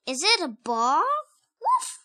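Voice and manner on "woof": high, playful woof; puppy bark. Acted. Child barks as Biscuit.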